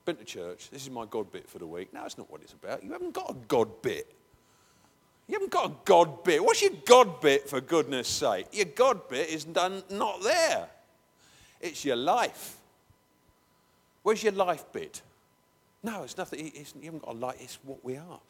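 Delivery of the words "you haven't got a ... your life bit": loud and insistent, much louder; rising fervent rebuke; preaching loud. Real, not acted. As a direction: press the point hard at the congregation. Preacher rebukes congregation loudly.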